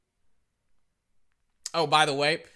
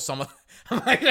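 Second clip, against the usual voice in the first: laughing; 11 semitones higher, 5 dB louder than usual. Real, not acted. Laughing at own confession.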